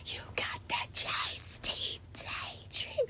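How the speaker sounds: high, strained, voiceless rasp; singing along with a blown-out voice; voice gone. Retold or imitated. At the Eras Tour after screaming all night, voiceless high croak. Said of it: "My voice was gone, so that's why I sounded like that".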